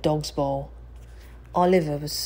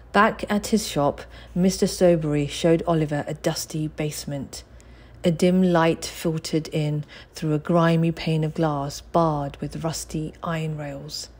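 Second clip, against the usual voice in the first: plain reading voice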